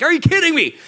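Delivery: high, loud exasperated disbelief. Retold or imitated. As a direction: cry out at the bad luck. Disbelief at bad luck.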